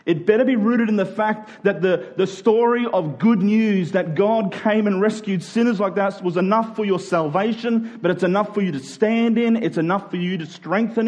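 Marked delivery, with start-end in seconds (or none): none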